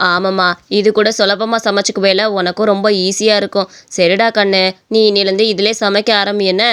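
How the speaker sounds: low-pitched elder mother-in-law; mother-in-law, agreeable. Acted. (தாழ்ந்த குரலில் ஊக்கப்படுத்தும் மாமியார்)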